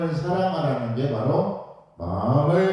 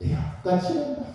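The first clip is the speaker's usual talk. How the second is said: cheerful father; 11 semitones higher, 3 dB quieter than usual. Retold or imitated. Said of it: "스물세 번 물어봤습니다".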